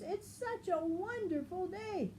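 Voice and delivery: high, sing-song cheer; cheerful Marabou. Acted. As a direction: gush over the day up high. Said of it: "asked the others".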